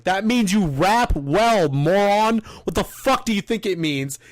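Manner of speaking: loud, slow and cutting, hitting each word; he spits it in the guy's face, loud and slow, calling him a moron; angry retort. Retold or imitated. Retold angry face-off, standing up.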